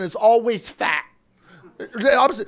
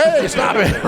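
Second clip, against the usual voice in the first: higher, protesting mock shout; playful raised-voice telling-off; mock scolding; 5 semitones higher, 3 dB louder, slower than usual. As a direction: shoo the cat off, half laughing.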